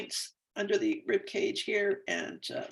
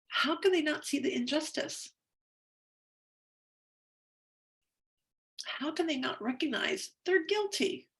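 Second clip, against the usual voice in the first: indignant questions; 10 semitones higher, 4 dB louder than usual. Acted. Indignant questions while tapping.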